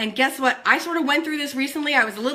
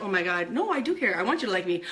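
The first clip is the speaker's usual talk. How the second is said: lower, softer, whiny begging; half-laughing plea to be liked; mock pleading; 6 semitones lower, 6 dB quieter, faster than usual. Her own needy voice, lower, softer and pleading. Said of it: "I'm like".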